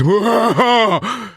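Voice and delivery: loud high-pitched shocked cry; high, wailing yelp of shock; great shocked noise. A narrator's reading. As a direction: cry out as if shot in the belly. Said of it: "made a great shocked noise".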